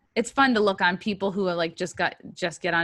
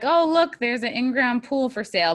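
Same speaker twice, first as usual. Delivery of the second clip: high, louder mock excitement; pitched-up sarcastic sales patter; mock tour guide; 6 semitones higher, 5 dB louder than usual. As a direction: show off a sorry sight like a prize. Mocking tour of town, acted.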